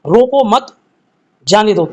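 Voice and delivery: "रोको मत", pause, then "जाने दो" dropping at the end; pause after मत. Shown as a demonstration. Teacher giving students the correct sentence, pause after मत. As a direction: hold a comma break between "रोको मत" and "जाने दो". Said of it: "यानी इसका सही जो वाक्य बनेगा".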